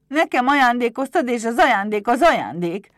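high, loud protesting imp; indignant kobold. Acted. Pumukli refuses to give back the gift, high indignant shout. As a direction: argue back like a stubborn little goblin.

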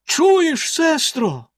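very high, excited call; sudden high-pitched exclamation; exclaiming. A narrator's reading. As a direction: cry out the news to your sister. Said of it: "вигукнула вона за мить".

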